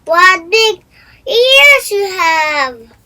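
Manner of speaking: put-on a child marveling at the wolf in disguise; wide-eyed girl. A narrator's reading. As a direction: stretch out the wonder. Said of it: "she said".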